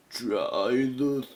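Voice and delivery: loud, drawn-out hammed-up pitch; mock promotional voice. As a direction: stretch it out like an over-the-top product plug. Acts out the instructions' pitch.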